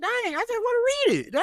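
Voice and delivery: high exasperated complaint; whiny pushback; exasperated whine. Real, not acted. Whining about being made to sing.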